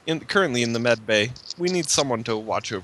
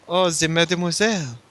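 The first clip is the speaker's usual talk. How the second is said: put-on French-accented dreamy exclamation; lightly put-on French accent; Francis; 3 semitones higher than usual. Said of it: "He gets all French".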